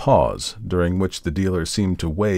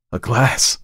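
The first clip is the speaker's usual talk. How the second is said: a touch of hoarseness, raised pitch; shocked, mildly hoarse cry; hoarse exclamation; 5 semitones higher, 4 dB louder than usual. A narrator's reading. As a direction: catch your breath on it, slightly hoarse. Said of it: "he said hoarsely".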